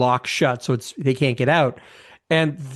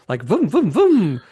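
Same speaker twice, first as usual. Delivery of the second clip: drill noise; 4 semitones higher than usual. Retold or imitated. Imitating the surgical drill.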